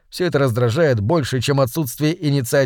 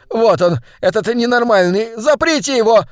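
gleeful accusation; 8 semitones higher, 3 dB louder than usual. Acted. Fat man triumphs over offender.

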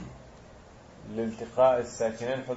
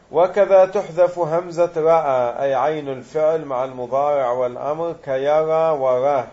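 reciting the text; 6 dB louder than usual. Acted. Reads the grammar text aloud.

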